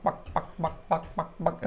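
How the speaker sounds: quick soft clucks, mimicking a rooster; mimicked rooster tidbitting. Showing the fans how a rooster calls hens to food, soft quick clucks. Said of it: "they will do sort of like a".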